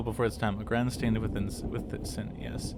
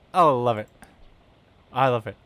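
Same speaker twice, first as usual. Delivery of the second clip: much louder, thrilled; delighted; 10 dB louder than usual. Real, not acted. Player thrilled with the new fedora, loud. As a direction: gush over the hat.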